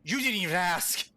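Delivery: accusing brother. Acted. Brother accusing the sibling who abandoned belief, rising to angry shouting. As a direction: start contained, then erupt in accusation. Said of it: "hear your brother voice".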